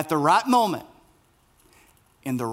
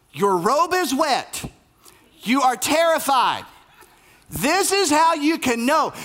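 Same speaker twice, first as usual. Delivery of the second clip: witty, sarcastic angel; 8 semitones higher than usual. Acted. A sarcastic angel scolds Zechariah, higher and exasperated. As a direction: scold the old priest for doubting. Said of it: "I love a witty, sarcastic angel".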